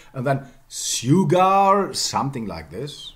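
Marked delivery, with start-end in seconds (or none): 0.7-1.9 SUGAR as "syoo-gar", long and loud; Middle English pronunciation. Shown as a demonstration. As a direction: draw out sugar with s plus y and a full -ar. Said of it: "yeah, in Middle English".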